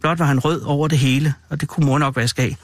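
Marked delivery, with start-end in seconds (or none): none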